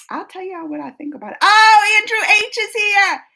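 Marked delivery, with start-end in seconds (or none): 1.4-3.2 loud, high delighted welcome; light up as a friend shows up; excited greeting. Real, not acted. Greeting viewer Andrew as he joins the stream, loud and delighted.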